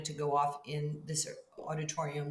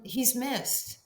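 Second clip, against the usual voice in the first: tender grief; 6 semitones higher than usual. Real, not acted. Grief for Kobe.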